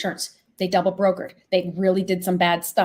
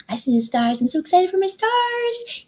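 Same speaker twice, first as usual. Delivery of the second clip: high-pitched gleeful gushing; giddy excitement; 12 semitones higher, 3 dB louder than usual. Real, not acted. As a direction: squeal it, thrilled to show this off. Gushing over a new feature.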